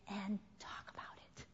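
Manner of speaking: whispered closing words; soft whispered tag. Real, not acted. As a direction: let it fall to a whisper. Unvoiced trailing.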